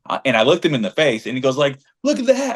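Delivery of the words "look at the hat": high-pitched put-on protest; mock retort. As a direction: mimic an indignant opponent way up high. Retold quarrel, imitates man's retort.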